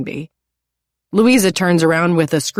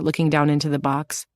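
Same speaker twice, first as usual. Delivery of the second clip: ordinary delivery, nothing marked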